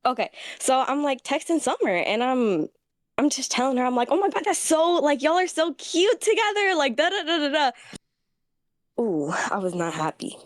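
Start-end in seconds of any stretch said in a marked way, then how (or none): 4.1-7.7 mock gushing. Retold fake gushing to Summer.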